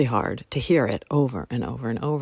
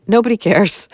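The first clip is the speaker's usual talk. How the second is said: higher, louder, delighted "Nobody cares"; freeing; 5 semitones higher, 7 dB louder than usual. Retold or imitated. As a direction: toss it off like sudden relief. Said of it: "totally freeing".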